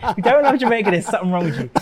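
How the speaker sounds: playful declaration through laughter; real amused laughter in the words; laughing tease. Real, not acted. Joking about loving Jamaica, laughing.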